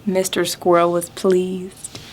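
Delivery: plain reading voice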